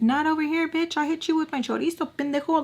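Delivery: high, fast sassy retort; sassy comeback. Acted. Mock sassy comeback to sexist scolding.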